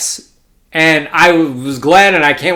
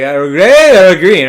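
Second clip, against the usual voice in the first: loud mimicry with a long, raised GRAY; slow, higher-pitched, exaggerated impression of the massage dealer; imitated massage dealer; 4 semitones higher, 9 dB louder, much slower than usual. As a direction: mimic her, dragging the vowels out slowly. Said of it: "it sounded kinda like that".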